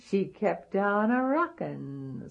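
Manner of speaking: ordinary delivery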